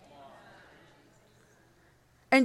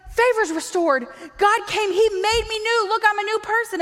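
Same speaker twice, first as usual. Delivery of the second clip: high-pitched, hammed-up evangelist; eager insistence; 7 semitones higher than usual. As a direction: insist excitedly on the good news.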